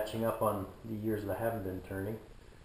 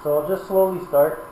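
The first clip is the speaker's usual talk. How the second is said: loud; 5 dB louder than usual. Real, not acted. Talking over a running lathe.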